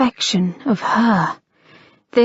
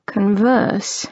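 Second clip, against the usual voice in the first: amused repeat. A narrator's reading. Harry teasing his lady about her word, dry. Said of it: "She glared at him".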